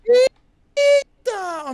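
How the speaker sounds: high, loud startled cry; startled shout. Real, not acted. Seeing the giant hand mob, a startled high cry. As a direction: jump at the sight, crying out.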